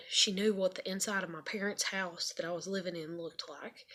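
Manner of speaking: ordinary talk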